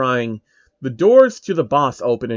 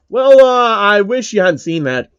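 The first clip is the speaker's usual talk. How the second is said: higher and louder, awkward Heathcliff voice; caught out; 7 semitones higher, 6 dB louder than usual. Acted. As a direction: sound like a villain caught red-handed. Heathcliff caught being invincible.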